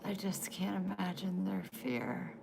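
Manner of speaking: whispered, barely voiced. Real, not acted. Whispered to the interviewer about the chickens' fear. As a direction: breathe it out, almost no voice.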